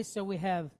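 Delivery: normal talking voice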